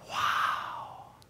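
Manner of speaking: hushed, breathless wow; awed whisper. Retold or imitated. First sight of the ocean after a long drive, whispered awe. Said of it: "I was like".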